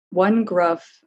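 ordinary delivery

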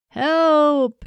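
loud high cry for help; shouted. Acted. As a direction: yell it out from the water. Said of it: "he shouted".

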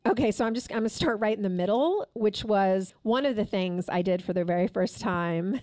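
ordinary talk

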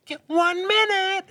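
high, slow mocking quote; mock guy voice. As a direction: squeak it out like a pleading guy. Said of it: "you guys are like".

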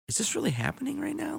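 disbelieving. Retold or imitated. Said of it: "I was like".

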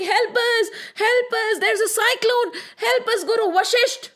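high, frightened pleas for help; frightened cries. Acted. Floating students call to their guru, high frightened cries. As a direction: students caught in the cyclone beg, mock-frightened and high. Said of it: "They started shouting".

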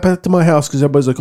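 ordinary talk

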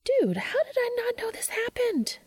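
high excited whisper. Acted. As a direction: whisper it, barely containing the thrill. Charlie tugs arm during talk.

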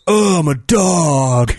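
slow, drawn-out dopey dog line; dog voice again. Retold or imitated. Retelling what the dog voiceover really said, slow. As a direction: let it drag like a bored dog. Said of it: "he actually goes".

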